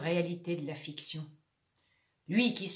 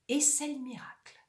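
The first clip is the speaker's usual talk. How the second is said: whisper; 4 semitones higher than usual. Acted. Whispered miracle announcement.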